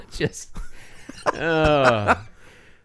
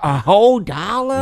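incredulous; 8 semitones higher, 6 dB louder than usual. Real, not acted. Incredulous reaction.

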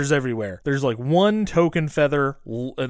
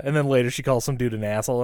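ordinary talk, nothing marked

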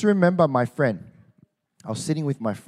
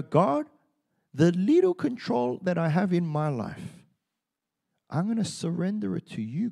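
prayer voice; 5 semitones higher than usual. Acted. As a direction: pray it gently, letting go. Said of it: "We go".

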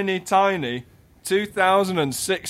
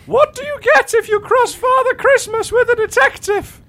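loud, very high falsetto impression; Emily Blunt; 6 dB louder than usual. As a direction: shriek the joke in a silly high voice. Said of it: "Definitely Emily Blunt".

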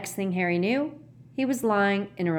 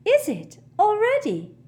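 high, airy vagueness; absent-minded high-pitched query; vague; 6 dB louder than usual. A narrator's reading. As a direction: sound vaguely surprised, pitched well up. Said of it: "said Luna vaguely".

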